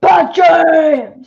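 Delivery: loud, high superhero shout; Bat James voice. Pretend. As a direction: belt out your bat hero name. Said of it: "I wanna hear the Bat James voice".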